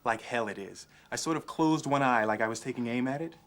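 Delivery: ordinary delivery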